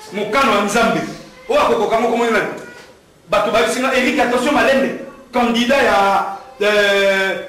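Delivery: loud, high impassioned oratory to a crowd; fiery campaign speech in French and Lingala; rally speech. Real, not acted. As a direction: rouse the crowd like a campaign preacher.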